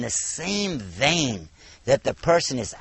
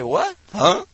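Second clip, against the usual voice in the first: higher, startled what and huh; caught off guard; 7 semitones higher, 5 dB louder than usual. Retold or imitated. Disarmed critic reacting to a kind answer, startled. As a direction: thrown off balance by kindness.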